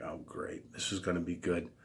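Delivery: whispered under the breath, dry and sarcastic; sarcastic aside. Retold or imitated. To himself as the pest beelines over, a sarcastic whisper. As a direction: murmur it to yourself as trouble walks over. Dreading the guy's approach.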